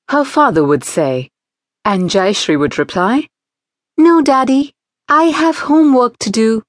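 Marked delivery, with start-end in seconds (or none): 4.0-6.6 put-on girl's voice. A narrator's reading. Girl refuses father, voiced.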